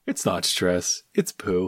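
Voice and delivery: a hint of a mild, low bear voice; Winnie the Pooh. Correcting Christopher Robin as the bear, lightly voiced low and soft. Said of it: "That's your Pooh voice?".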